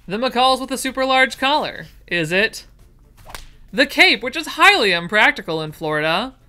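high, loud host listing the options; mock game show. Listing the patterns to viewers, mock game show host. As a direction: present each one like a prize.

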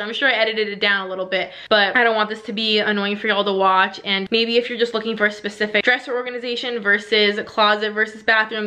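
normal talking voice

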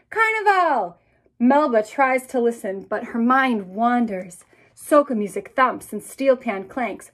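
lively read-aloud narration; storybook narration. A narrator's reading. Author reading To Carnival to classrooms, lively. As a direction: paint the carnival for the children.

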